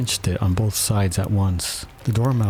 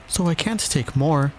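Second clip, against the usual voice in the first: high, mildly huffy objection; offended; 8 semitones higher than usual. A narrator's reading. Alice refusing the offer of more, offended. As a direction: finish the objection high and affronted. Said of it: "in an offended tone".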